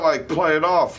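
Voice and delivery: ordinary talk, nothing marked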